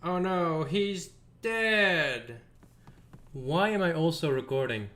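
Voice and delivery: usual speaking voice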